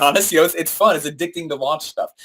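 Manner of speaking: normal talking voice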